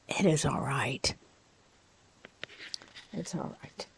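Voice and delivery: soft whispered reassurance. Acted. Tutor soothing a student, whispered. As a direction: whisper it gently.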